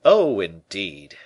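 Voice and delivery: loud, drawn-out "oh, indeed"; exclaimed. A narrator's reading. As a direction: exclaim it slowly, not taken in. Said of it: "exclaimed the Gascon".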